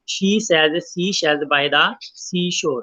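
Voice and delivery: very slow, each s sound drawn out; slow tongue twister. Shown as a demonstration. Teacher shows an s twister to students, very slowly. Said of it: "सा sound की ... repetition".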